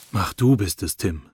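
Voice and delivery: usual speaking voice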